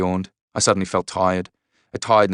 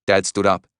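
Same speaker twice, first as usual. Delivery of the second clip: plain reading voice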